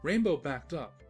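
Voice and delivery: ordinary delivery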